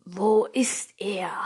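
low, slow menacing whisper; growled whisper. A narrator's reading. A man hunting for Jack, whispered growl. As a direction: growl it under your breath, slowly. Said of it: "knurrte einer der Männer".

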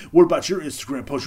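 loud, nagging imitation of critics; mocking critics. Critics talking shit about him, in a loud mock accusation. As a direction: bark it out like an accuser. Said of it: "people, like, talk shit about me".

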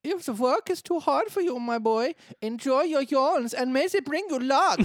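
high, loud German piggy bricklayer; camp German pig. Acted. Bricklayer sending the lazy boy off, camp German pig voice. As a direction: dismiss him as a sassy German pig. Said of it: "So really camp, offensive German oink".

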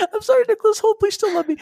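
high-pitched, laughing mock apology; mock pleading. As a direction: beg forgiveness playfully, voice way up high. Mock apology to absent actor.

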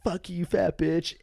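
high, drawn-out retold taunt; prank-call taunt. Guest retells phoning clerk just to insult her, high taunting. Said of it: "I called the hotel".

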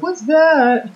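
high-pitched, incredulous quote; astonished mock question. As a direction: squeak it out in mock amazement. Acting out character's astonished reaction.

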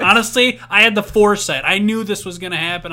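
loud mock-proud boasting; excited. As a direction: brag loudly, tongue in cheek.

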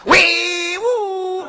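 威武 called high and drawn out in a Shaanxi accent; Shaanxi-style court shout. Shown as a demonstration. Yamen runners shout 威武 in a high Shaanxi accent. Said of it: "不是这个口音".

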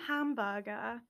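hamburger without r's, ending in "-buh-guh"; rough attempt at a British accent on hamburger; friend's British accent. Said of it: "I don't know how to do the accent".

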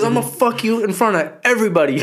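high, loud laughing Tyson impression; mock Tyson threat. Retelling Tyson's press conference line to a friend, high and laughing. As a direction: squeal the threat through laughter. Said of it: "He goes".

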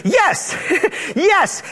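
high-pitched, eager mock-divine yes; emphatic yes. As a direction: answer with a delighted, high yes. God voiced eagerly answering prayer.

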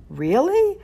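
high, bright jump of surprise; the mother hearing her money is found, surprised. A narrator's reading. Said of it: "the woman asked in surprise".

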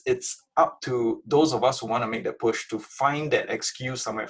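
normal talking voice

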